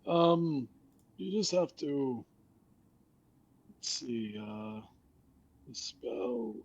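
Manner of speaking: high, rambling forgetful skeleton. Acted. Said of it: "He says".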